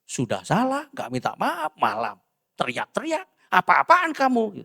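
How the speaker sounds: mock-scold the wife; a hint of an angry husband.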